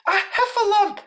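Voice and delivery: very high, stammering squeak; breathless Piglet. A narrator's reading. Piglet reporting to Christopher Robin, breathless high stammer. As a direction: stammer it out as if winded. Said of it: "breathing so hard that he could hardly speak".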